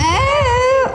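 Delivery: a real jolt of surprise, called out; surprised oh. Real, not acted. Sees the seat filled again.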